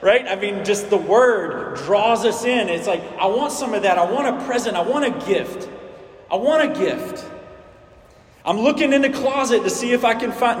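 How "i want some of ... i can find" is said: lightly voiced eager child, pitched higher; a hint of a kid wanting presents; eager kid at Christmas. Preacher playing a kid wanting a gift, lightly childlike and eager. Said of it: "It's like a kid around Christmas".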